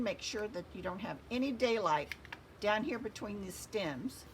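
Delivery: ordinary talk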